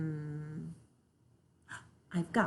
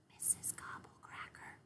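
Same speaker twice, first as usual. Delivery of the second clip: soft breathless whisper of the name; 24 dB quieter than usual. Shown as a demonstration. Brother showing how to say the scary name, whispered. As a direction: whisper the name as if she might hear. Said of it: "you have to whisper when you say her name".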